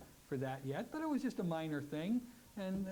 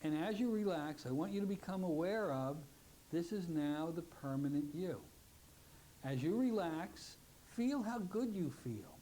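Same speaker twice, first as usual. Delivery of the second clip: guided relaxation; 2 semitones lower than usual. Shown as a demonstration. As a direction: ease them down into calm. Leads closing relaxation.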